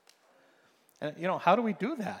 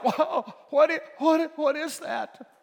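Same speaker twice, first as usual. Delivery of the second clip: much higher, stunned "whoa, what is that"; astonished; 12 semitones higher, 6 dB louder, slower than usual. Retold or imitated. Pastor acting the atheist's amazement, high stunned voice. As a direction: gasp it out like something just hit you.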